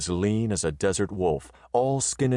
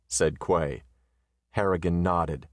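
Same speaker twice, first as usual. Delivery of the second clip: ordinary delivery, nothing marked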